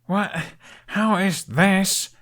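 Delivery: slow, high exasperated stammer. A narrator's reading. Hiccup staring at the wreckage, high exasperated stammer. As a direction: sputter it in disbelief. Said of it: "as he stammered".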